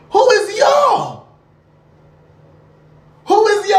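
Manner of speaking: high, slow, loud demand; offended shout. Acted. Answering the thirteen-year-old cousin, indignant high shout. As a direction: fire it back, indignant.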